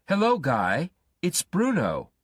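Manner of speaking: high, loud, eager phone greeting; Bruno calling. Acted. Bruno calling Guy on the phone, high and loud.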